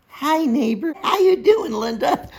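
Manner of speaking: very high, sing-out greeting; mock friendly neighbor. Builder parodying neighbors greeting Linda, mock friendliness. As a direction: imitate an over-sweet hello next door.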